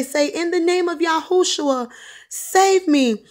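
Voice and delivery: high, forceful invocation; feed him the prayer in a high urgent cry; impassioned, loud on the last words; urgent prayer command. Retold or imitated. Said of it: "Repeat after me right now".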